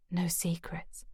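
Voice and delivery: soft breathy whisper of the words; whispered echo. Acted. Grace echoing Declan's promise to herself, whispering. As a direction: repeat it to yourself under your breath. Narrator echoes his words softly.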